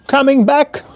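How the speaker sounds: lifted high, half sung; high sing-song. Acted.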